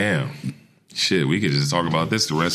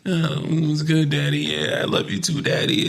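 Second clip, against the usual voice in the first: mocking [name]; 8 semitones higher than usual. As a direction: tease [name] cooing on camera. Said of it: "So, you on camera".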